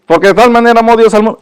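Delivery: fast rattled-off memorized verse; quick rote-memory recital; rote recitation. Shown as a demonstration. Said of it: "Ellos decían este versículo así".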